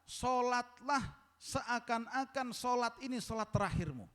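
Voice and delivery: high-pitched preacher's proclamation; proclaimed exhortation. Acted. Proclaims translation, then repeats it low.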